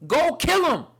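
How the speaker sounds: high, loud repeated order from God; booming voice of God; divine command. Retold or imitated.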